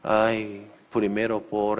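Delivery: usual speaking voice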